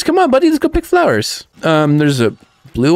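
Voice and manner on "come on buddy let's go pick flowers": higher sing-song coaxing; pet talk. Real, not acted. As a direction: coax the buddy to come. Coaxing robot to pick flowers.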